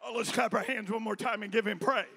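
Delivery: ordinary talk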